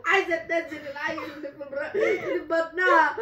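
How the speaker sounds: high loud hammed-up crying; mock tearful outrage. Mother fakes crying outrage to her son Ali. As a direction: fake weeping to the son.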